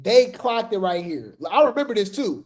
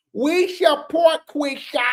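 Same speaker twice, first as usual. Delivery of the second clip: slow, higher put-on preachy voice; Rock Tar voice; 5 semitones higher, slower than usual. Shown as a demonstration. Mocking the fans' flyer for viewers, in a slow Rock Tar voice. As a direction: drag out the slogan like a devoted fan. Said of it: "I gotta do this in my Rock Tar voice".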